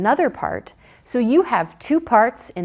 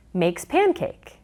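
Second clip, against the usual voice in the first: ordinary delivery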